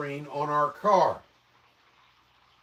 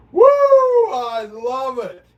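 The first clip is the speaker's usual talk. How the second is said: loud, high, slow whoop of delight; loud, high, drawn-out cheer; 7 semitones higher, 10 dB louder, slower than usual. Real, not acted. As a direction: cheer it out big. Delighted cheer at funny Brits.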